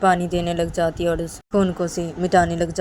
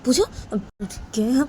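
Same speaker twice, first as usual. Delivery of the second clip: shocked disbelief; 9 semitones higher than usual. Acted. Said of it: "वो लड़का बोलता है".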